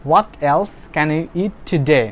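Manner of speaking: very slow, every word separated for the class; slow model sentence. Shown as a demonstration. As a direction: read it out slowly like a dictation. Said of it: "teacher models the English sentence". (ধীরে ধীরে ক্লাসে পড়ে শোনানো)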